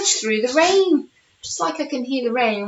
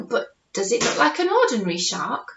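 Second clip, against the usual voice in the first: ordinary delivery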